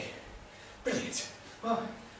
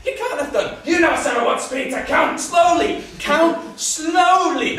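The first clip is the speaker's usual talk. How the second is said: raised-voice exasperated instruction; exasperated correction; 9 semitones higher, 9 dB louder than usual. Acted. Exasperated helper corrects angry man.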